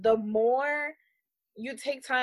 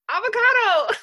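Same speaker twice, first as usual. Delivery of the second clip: high, loud one-word reply; imitated friend's answer; 13 semitones higher, 8 dB louder than usual. Host imitates friend explaining gunk on his face, high and loud.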